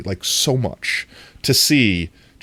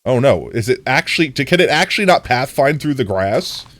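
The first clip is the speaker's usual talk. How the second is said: usual speaking voice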